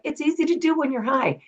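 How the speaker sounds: a light impression of the coach joking back; deadpan joke. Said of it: "And he's like".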